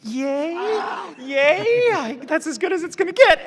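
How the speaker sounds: high, mock-delighted yay, dripping with sarcasm; sarcastic cheer. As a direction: cheer it up high like a fake celebration. Mock cheer after lukewarm director feedback.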